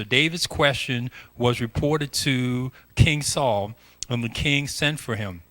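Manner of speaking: normal talking voice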